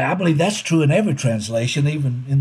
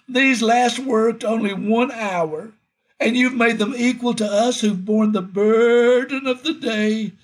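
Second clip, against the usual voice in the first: grumbling workers; 8 semitones higher than usual. Retold or imitated. Preacher imitating the grumbling workers to the master, mock whiny. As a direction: whine it like an aggrieved laborer. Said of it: "They grumbled at the master of the house".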